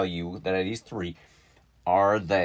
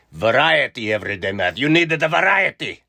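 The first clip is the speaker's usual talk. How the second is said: loud, higher ad-slogan voice; mock slogan shout; 6 semitones higher, 9 dB louder than usual. Real, not acted. Teacher hamming up the program slogan, loud. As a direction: sell it like a jingle.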